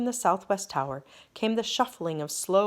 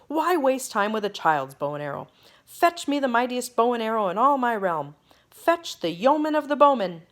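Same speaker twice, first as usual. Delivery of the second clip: building louder and higher; ringing royal command; 6 semitones higher, 6 dB louder than usual. Acted. King calling for the archer, ringing louder command. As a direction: boom it across the palace. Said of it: "the king's voice rang out".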